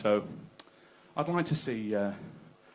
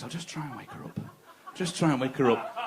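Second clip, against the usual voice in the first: sneaky thought; 5 dB quieter than usual. Retold or imitated. Man thinking sneakily.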